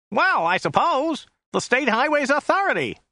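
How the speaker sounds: high-pitched, puzzled American; flummoxed driver. A narrator's reading. Driver guessing who put up the sign, high and flummoxed. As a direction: answer high, a bit thrown. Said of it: "a bit flummoxed".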